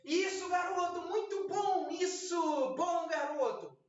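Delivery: high, bright praise for a puppy; happy praise. Shown as a demonstration. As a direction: cheer the puppy on. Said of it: "Puxa aqui a gradezinha".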